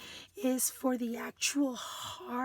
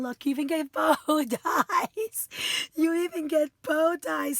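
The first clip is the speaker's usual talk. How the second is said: loud, laugh-tinged excitement; excited delight; 2 semitones higher, 6 dB louder than usual. Real, not acted. Crafter showing friends the bow dies, loud and excited. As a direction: burst out over a happy find.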